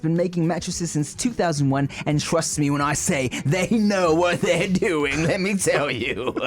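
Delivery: upbeat host-read sponsor pitch. Acted. Podcast host reading a sponsor spot, upbeat ad read. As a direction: sell the mattress like a friendly plug.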